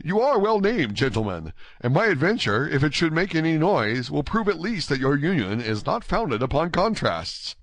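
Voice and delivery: courteous, eager Gascon youth; spirited, gallant D'Artagnan. Acted. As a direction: declare it with youthful bravado. Narrator voices D'Artagnan's spirited reply.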